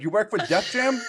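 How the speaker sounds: shouting stranger. Retold or imitated. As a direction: shout it from the next car over.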